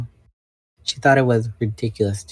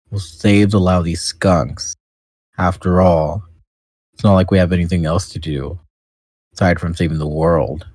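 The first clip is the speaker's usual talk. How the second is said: put-on deep teenager voice; 3 semitones lower, 5 dB louder than usual. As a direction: drag it out like a sulky Eeyore. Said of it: "he just sounds like Eeyore now".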